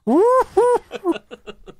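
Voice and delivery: high, loud put-on laugh; mom laughing hard. Mom laughing at her son on the toilet, high cackle. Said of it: "she's laughing at me".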